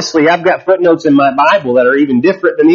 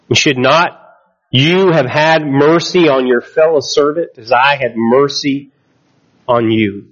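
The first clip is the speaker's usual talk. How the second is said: measured, lower Bible reading voice; scripture reading; 3 semitones lower than usual. Acted. Pastor reading scripture to the church, measured and low. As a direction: read the passage to the congregation, steady and measured. Pastor reads Matthew aloud.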